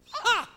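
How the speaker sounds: sharp, high, loud scornful "Ha!"; scornful laugh. Acted. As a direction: bark one derisive laugh at the fool. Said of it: "A laugh of scorn".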